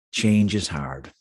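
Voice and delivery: slow, lightly voiced Canadian-Irish accent; a hint of Canadian-Irish, words drawn out slowly; Canadian-Irish priest. Old priest answers man torn over a job, slow Canadian-Irish accent. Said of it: "with his Canadian-Irish voice".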